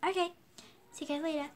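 low, quiet pet voice; a lightly voiced pet with a Japanese accent; Ling Ling the pet, a hint of a Japanese accent, said low and soft. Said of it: "she still has her Japanese accent".

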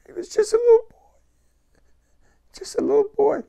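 real grief cracking the voice up high; choking up on a painful memory; voice breaking. Real, not acted. Veteran recalling the dying soldier, voice breaking. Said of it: "Excuse me if I get a little emotional".

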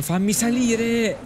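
real frustrated slow shout; exasperated yell. Real, not acted. Exasperated third attempt.

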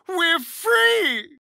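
high, loud cry of relief; joyful shout. Acted. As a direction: shout the news with joy. Tadpole gone, elated.

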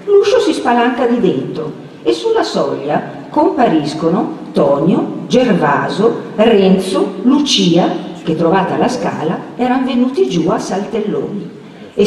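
usual speaking voice

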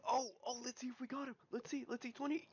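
high, rapid, breathless suspense; excited anticipation. Real, not acted. Gamer checking if the kill landed, excited anticipation. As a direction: build up fast and high toward a big win.

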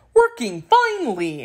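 Scarecrow. A narrator's reading. Scarecrow's dialogue in story.

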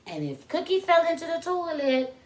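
usual speaking voice

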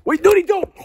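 high, loud panicked interruption; alarmed warning. Acted. Friend warning about the doctored candy, high alarm. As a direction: cut in urgently, pitch shooting up.

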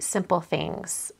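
ordinary talk, nothing marked

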